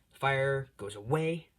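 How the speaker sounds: ordinary talk, nothing marked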